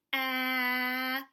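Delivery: long loud sustained ah; held ah sound. Shown as a demonstration. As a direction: hold the ah as long as breath lasts.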